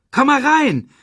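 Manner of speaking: high, loud playful call. Acted. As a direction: shout from the water to join. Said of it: "rief er".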